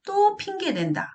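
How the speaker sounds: high-pitched imitation of a scolding parent; dismissive parent. Parent dismissing the child's words, high scolding complaint. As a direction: mimic a parent brushing off the child. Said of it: "이런 식으로 표현을 하거든요".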